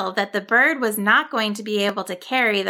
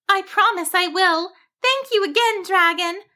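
high-pitched, grateful sheep voice; cheerful little sheep; 10 semitones higher than usual. Acted. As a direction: pitch it way up, sweet and thankful.

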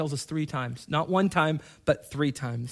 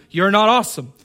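louder, punched rebuke; emphatic; 3 semitones higher, 7 dB louder than usual. Real, not acted. Preacher to the church, firm and louder. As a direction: tell them flatly they're not awesome. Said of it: "I keep reminding you".

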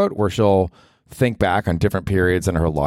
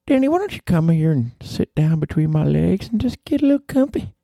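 granny voice; 11 semitones higher than usual. Acted. Granny inviting Danny to sit down, high and seductive. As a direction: purr it up high like a randy grandma. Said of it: "Grandma, you've gotta stop talking".

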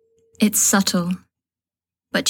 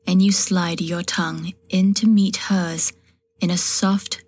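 ordinary delivery, nothing marked

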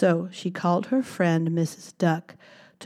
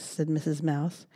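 soft story ending; 8 dB quieter than usual. A narrator's reading.

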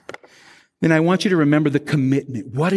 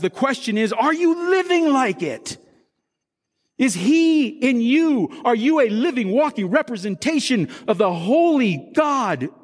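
impassioned preaching; 5 semitones higher than usual. Real, not acted. Pastor challenging the baptized to live like it, impassioned preaching.

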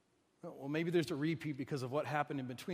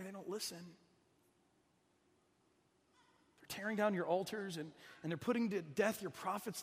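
raw lament; 2 semitones higher, 7 dB quieter than usual. Acted. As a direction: let the hurt spill out quietly. Elijah pours out his hurt.

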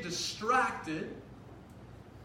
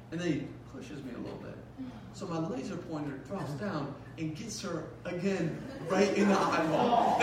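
usual speaking voice

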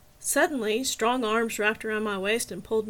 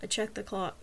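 plain reading voice

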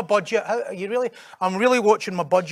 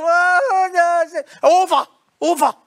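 higher, louder mock gasp of defeat; mock dismay; 10 semitones higher, 7 dB louder, slower than usual. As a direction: act out the moment it all falls apart.

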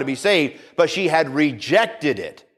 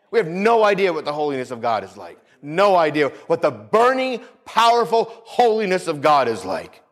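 usual speaking voice

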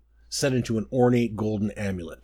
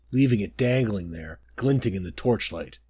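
usual speaking voice

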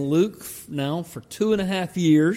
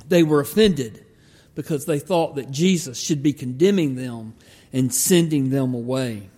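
ordinary talk

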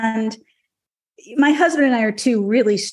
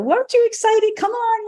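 mock husband, much higher pitched; husband's coaxing; 13 semitones higher than usual. Mimicking her husband urging her to be excited, high mock plea. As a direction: pitch it way up to mimic his pleading.

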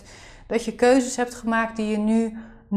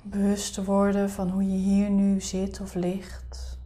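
soft spa whisper; 3 semitones lower, 9 dB quieter than usual. Acted. Leads a guided meditation.

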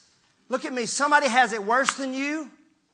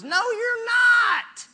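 emphatic rebuke; 12 semitones higher, much slower than usual. Real, not acted. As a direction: cut the complaint off hard. Rebuking the complainer.

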